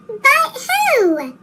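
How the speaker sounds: ordinary delivery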